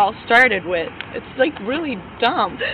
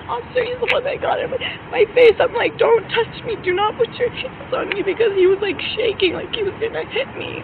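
high, tearful, distressed voice; real upset; 9 semitones higher than usual. Real, not acted. Upset woman telling an officer he got in her face, distressed. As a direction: plead your case, voice pitched up and close to crying.

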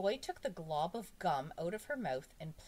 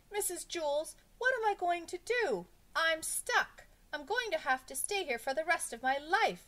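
high, loud schoolboy despair; melodramatic, high-pitched distress; panicked wail; 12 semitones higher, 6 dB louder than usual. A narrator's reading. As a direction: wail helplessly to the teacher. Stuck pupil wails for help.

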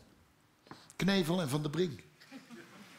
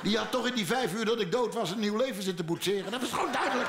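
laughing; 3 semitones higher than usual. Real, not acted. Laughing at wife's disappointment.

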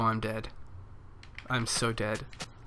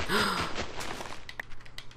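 sharp breathy gasp, high and hushed; dramatic gasp; 6 semitones higher, 5 dB quieter than usual. Pretend. Gamer gasping at a Minecraft mystery, hushed gasp. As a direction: gasp as if spotting a ghost.

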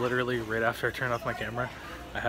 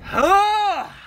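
sudden loud high yell; frustrated yell; 12 dB louder than usual. Real, not acted. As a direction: let out a frustrated scream. Frustrated outburst mid-sentence.

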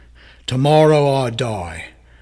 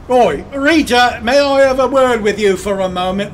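calling out; 6 semitones higher, 5 dB louder than usual. Acted. Photographer calls out to model.

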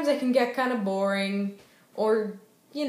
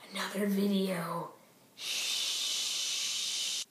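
whispered hush; 4 semitones lower, 9 dB quieter, much slower than usual. Real, not acted. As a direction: whisper and shush. Phone is uploading, hushing.

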